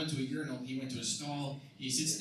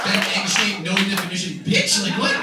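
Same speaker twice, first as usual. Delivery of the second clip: much louder indignant yell; loud outraged rant; 5 semitones higher, 11 dB louder than usual. Acted. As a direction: shout it, offended. Outraged comic rant at niece's remark.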